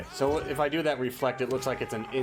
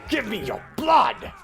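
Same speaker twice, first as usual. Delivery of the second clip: hungry shout; 11 semitones higher, 9 dB louder than usual. Acted. Player voices vampire attacking enemies.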